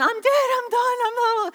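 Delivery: high-pitched dramatic wail of defeat; comic voice of someone giving up; mock despair. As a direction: play it as a hammed-up dying complaint.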